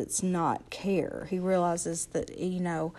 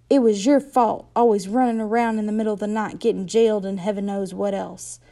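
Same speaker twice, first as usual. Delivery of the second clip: furious accusation; 2 semitones higher, 8 dB louder than usual. A narrator's reading. Mother blaming the boys, loud and fast. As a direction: hurl the blame at them.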